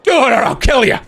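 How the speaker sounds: shouted threat, pitched high; angry shout. Acted. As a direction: yell it as a threat. Player shouts a threat in character.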